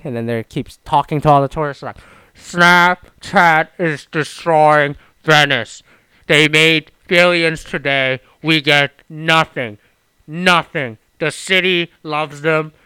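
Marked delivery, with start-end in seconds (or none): 2.3-12.7 slow, drawn-out protest speech, unlike his own voice; imitating protester on microphone. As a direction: chant it like a protester on a mic. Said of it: "the microphone going".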